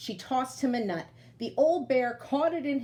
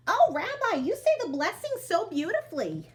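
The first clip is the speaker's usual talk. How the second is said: put-on admiring old woman; 8 semitones higher, 3 dB louder than usual. Baba Braina admires the blessings.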